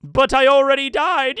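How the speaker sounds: usual speaking voice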